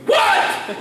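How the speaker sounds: high, exasperated mock yell; yelled reply. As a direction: holler back through an open door. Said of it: "as I'm yelling down".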